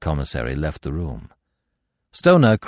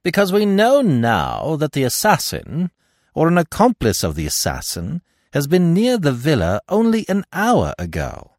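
high, loud, emphatic pronouncement; confident Giraud; 11 semitones higher, 5 dB louder than usual. A narrator's reading. Giraud explaining the assassin's return, confident and emphatic. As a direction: announce the deduction boldly at raised pitch.

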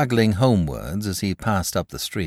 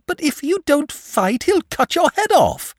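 put-on much higher, alarmed boy's cry; gasped; 4 dB louder than usual. A narrator's reading. Boy gasps in alarm at the dragon, pitched high. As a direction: blurt it out in dismay. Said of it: "gasped the boy".

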